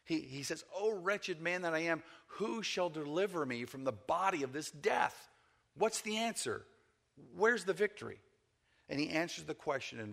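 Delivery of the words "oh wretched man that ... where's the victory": raised-pitch, mildly anguished questioning; frustrated pleading. Retold or imitated. Preacher voicing Paul's search for deliverance, lightly acted frustration. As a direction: retell it as a struggling man's plea. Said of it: "in his frustration, in his struggle".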